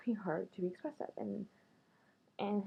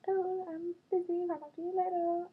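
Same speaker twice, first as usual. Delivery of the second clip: high, loud, slow sing-song mock; a flaky guy's excuse, hammed up; 9 semitones higher, 4 dB louder than usual. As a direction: drawl the brush-off in a mocking high voice. Mocking a flaky guy.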